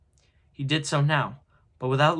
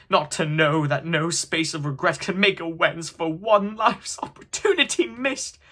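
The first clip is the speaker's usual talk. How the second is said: impassioned ghostly lament, pitched up and loud; crying out; 4 semitones higher, 7 dB louder than usual. A narrator's reading. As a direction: build in anguish to a high outcry. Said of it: "cried the phantom".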